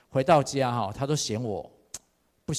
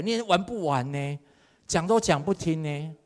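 ordinary talk, nothing marked

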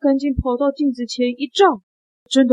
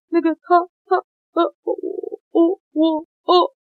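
high, halting broken words; flustered stammering; 7 semitones higher than usual. Acted. Assistant pointing at the hat to explain, flustered high stammer. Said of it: "魔术师助理他指着帽子说".

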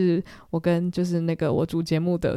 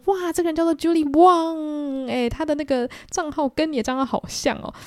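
higher, slowed, sincere announcing; earnestly; 9 semitones higher than usual. Retold or imitated. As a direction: read the name out with total seriousness. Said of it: "所以我还就很认真地说". (很认真又大声地念出名字)

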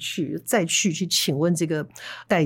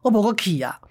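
chest-thumping mock denial, a little higher; retold loud denial; 4 semitones higher than usual. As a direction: swear it out loud like a gambler. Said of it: "他就拍着胸脯大声说". (拍胸脯大声否认)

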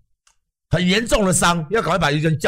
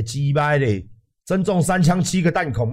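ordinary talk, nothing marked

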